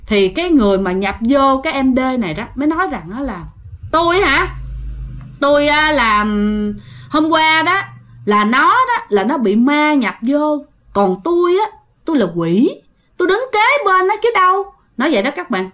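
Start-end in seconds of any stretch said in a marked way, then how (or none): 3.9-14.6 high, casual demon voice; nonchalant demon. Retold or imitated. Demon inside the girl casually explaining to the old woman. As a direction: answer offhand, as if it's nothing. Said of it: "Nói một cách tự nhiên".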